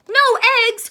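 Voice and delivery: high, loud cry of dismay; cried out. Acted. Farmer cries out at the hens' strike. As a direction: shout it in disbelief. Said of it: "cried Farmer Brown".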